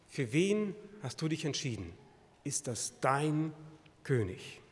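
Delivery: ordinary talk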